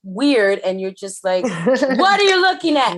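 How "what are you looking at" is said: high loud startled question; startled cat owner. Retold or imitated. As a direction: yell at the cat, spooked and laughing. Mock talking to the cat.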